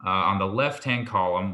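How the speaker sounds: usual speaking voice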